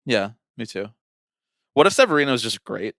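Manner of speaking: ordinary delivery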